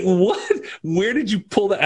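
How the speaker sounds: high, incredulous what; baffled disbelief; surprised. Retold or imitated. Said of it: "I'm like".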